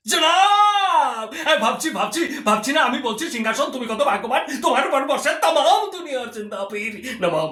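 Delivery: grovel theatrically to the nawab; hammy stage actor. Acted. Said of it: "Murshid Quli Khan বলছে". (চাটুকার সভাসদের নাটকীয় উঁচু গলা)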